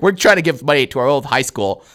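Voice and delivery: normal talking voice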